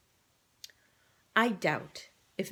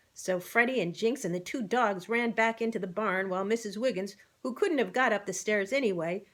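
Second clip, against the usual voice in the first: plain reading voice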